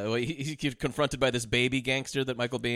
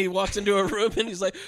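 normal talking voice

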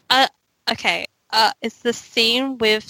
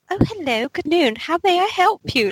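mock posh British accent on the phone, a touch higher; hammed-up British phone voice; 7 semitones higher than usual. Shown as a demonstration. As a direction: ham up a British accent answering a call. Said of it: "I really ham it up, like, the British accent".

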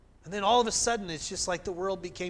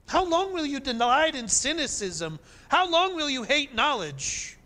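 raised, ringing plea of the ancient sage; proverb writer's voice; 7 semitones higher, 3 dB louder than usual. Acted. Preacher voicing the proverb writer, raised and pleading. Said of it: "the proverb writer saying".

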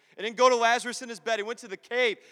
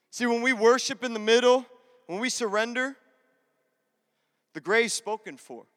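ordinary talk, nothing marked

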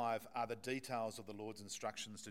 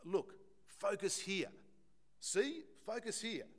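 a lightly voiced God, raised and slow, saying "focus here"; a hint of a patient divine voice, higher and slower; God calling for attention; 8 semitones higher, slower than usual. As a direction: urge them gently to look and focus. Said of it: "God is saying".

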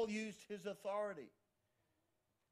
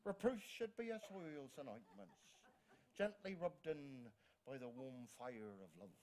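slow, softer Irish accent, pitched down toward the end; 5 semitones lower, 5 dB quieter than usual. Shown as a demonstration. Preacher re-reading the quote about gentle reproof, in an Irish accent. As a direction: say it slowly with an Irish lilt. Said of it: "You could say that in an Irish accent".

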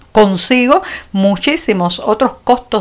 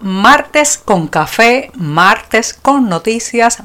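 very slow, each word savoured; slow drawn-out tagline; much slower than usual. Acted. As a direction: stretch it out like a show slogan. Slow show-opening tagline.

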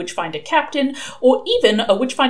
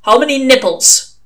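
loud, higher demand; brusque interrogation; 5 semitones higher, 8 dB louder than usual. Acted. Old witchfinder quizzing a recruit, brusque demand. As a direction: fire the question out loudly. Interrogator springs an odd question.

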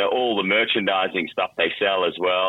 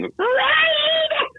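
very high shrill screaming "Raid!"; tiny insect screaming the brand name; screaming mosquito. Acted. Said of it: "and screaming".

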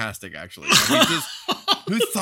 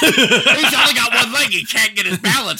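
loud, high jeering through laughter; gleeful raised-voice taunt; mock heckling; 3 semitones higher, 8 dB louder than usual. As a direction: crow it out, cracking up. Heckling a one-legged man, laughing.

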